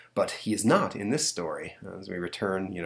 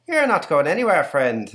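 leprechaun; 8 semitones higher, 7 dB louder than usual. Acted. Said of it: "apparently a leprechaun".

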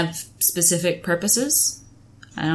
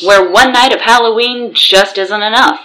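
announcer; 6 semitones higher, 10 dB louder than usual. Acted. Co-host joins intro.

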